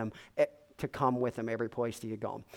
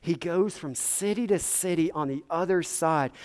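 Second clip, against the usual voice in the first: usual speaking voice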